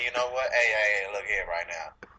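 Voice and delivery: low Southern preacher drawl, stretching out the final words; Matthew McConaughey impression. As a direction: preach it slow and low like a Southern movie star. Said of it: "that tone in his voice that he could be a fucking preacher".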